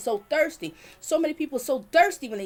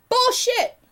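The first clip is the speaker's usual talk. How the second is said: higher, louder, real angry scoff; angry outburst; 8 semitones higher, 6 dB louder than usual. Real, not acted. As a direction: throw the excuse out in real anger. Angry rejection of the excuses.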